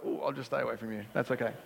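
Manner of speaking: low, soft, awkward retreat; backing off. Acted. Traveller finds the fellow Aussie not so nice, backing off quietly. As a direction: excuse yourself under your voice. Acting out backing off from disappointing stranger.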